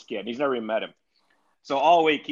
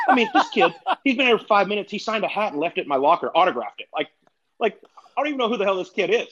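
imitating a worked-up golfer, pitched higher; retold indignant rant, mock outrage; fired-up ranting; 9 semitones higher than usual. Fired-up friend complaining about the kid in his locker, mock indignant ranting. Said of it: "he can get all fired up".

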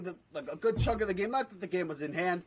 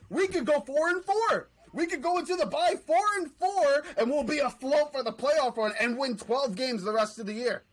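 heated rant; 5 semitones higher, 5 dB louder than usual. Real, not acted. Ranting about Cowboys fans.

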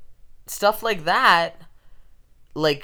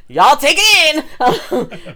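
laughing; 10 semitones higher, 7 dB louder, much slower than usual. Real, not acted. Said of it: "I don't even know I'm preaching".

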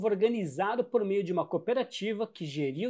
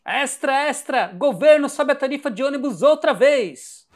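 high, loud newsboy shout; rapid old-time reporter; 5 semitones higher, 9 dB louder than usual. Acted. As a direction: shout the headline like a paperboy.

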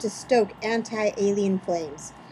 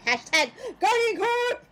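high, slow sing-song hashtag; hashtag flourish; 14 semitones higher than usual. Acted. Reading a fan tweet aloud, high drawn-out hashtag.

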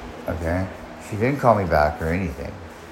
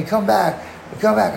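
high-pitched imitation of frantic pleading; retold tearful screaming; 10 semitones higher than usual. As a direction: plead high, mimicking a crying scream without the volume. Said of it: "she'd be crying, screaming at me".

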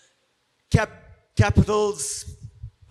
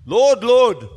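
pleading call; 2 semitones higher, 9 dB louder than usual. Acted. Quoting the pleading verse.

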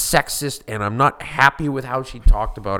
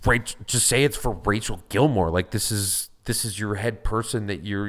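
normal talking voice

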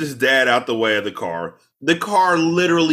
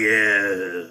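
husky cigarette voice; 6 semitones lower than usual. Retold or imitated. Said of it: "husky cigarette voice".

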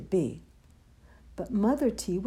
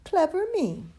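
ordinary delivery

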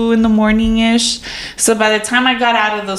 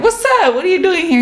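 surprised greeting; 9 semitones higher, much faster than usual. Retold or imitated.

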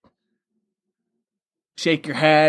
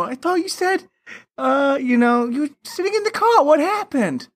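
mock character voice; 11 semitones higher than usual. Voicing film character's question.

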